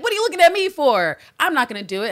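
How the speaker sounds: high, loud mock protest; indignant refusal. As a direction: push back hard, voice shooting up. Eve's indignant refusal, acted.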